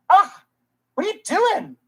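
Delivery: high, incredulous cry of disbelief; exasperated disbelief. Real, not acted. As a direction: ask it in shocked disbelief. Exasperated outburst at the clip.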